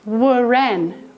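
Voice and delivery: wren said "waren" with the W sounded; old waren. Shown as a demonstration. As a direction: voice the W first: "waren". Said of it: "we used to say waren".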